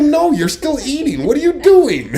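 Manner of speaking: loud, high, laughing protest; exasperated protest. Retold or imitated. Husband protesting to his wife who is still eating, loud and exasperated. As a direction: plead with her to stop rushing. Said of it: "I'm like".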